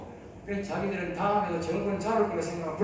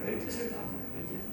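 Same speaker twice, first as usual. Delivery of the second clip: whispered repeat; 11 dB quieter, much faster than usual. Real, not acted. Repeats line, then drops to whisper.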